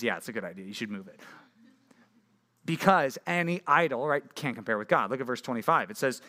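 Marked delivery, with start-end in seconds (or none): 0.0-1.2 much lower, much softer dry aside; understated wry agreement; quiet, dry divine quip; deadpan God. Retold or imitated. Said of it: "And God says".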